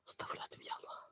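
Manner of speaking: sick, hushed plea; whispered prayer. Real, not acted. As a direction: breathe the prayer barely audible. Nauseous, praying in whisper.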